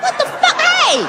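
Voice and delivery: high, shocked what the fuck; startled. Retold or imitated. Husband startled when his wife calls him boo. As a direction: jump like he's been spooked.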